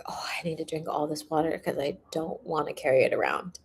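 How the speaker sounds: hushed whispered aside. Real, not acted. Vlogger confides her water habit to viewers in a whisper. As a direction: whisper it like a little secret.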